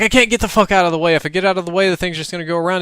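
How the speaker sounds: high, fast protest; exasperated yelling. Retold or imitated. Man yelling back at Austin during zombie attack, exasperated. Acted shouting quarrel with Austin.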